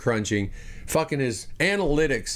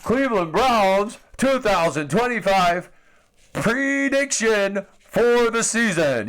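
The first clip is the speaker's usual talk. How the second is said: mock announcer intro, slow and drawn out; a hammed-up big broadcast announcement, higher and slower; 6 semitones higher, 3 dB louder, slower than usual. Opening his own season prediction segment, hammed-up announcer voice. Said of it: "Here we go".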